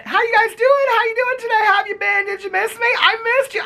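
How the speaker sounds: very high-pitched held squeal of greeting; gushing greeting. Acted. Host greeting viewers after not posting, high gushing squeal. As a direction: gush it all on one high note.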